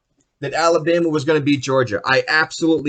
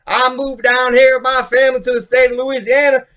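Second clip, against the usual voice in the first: mock Southern accent; 8 semitones higher, 5 dB louder than usual. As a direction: ham up a fake Southern accent. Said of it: "the guy has a fucking Southern accent".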